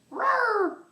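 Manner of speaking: squeaky high little roar; high dinosaur roar. Pretend. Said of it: "roar like a dinosaur".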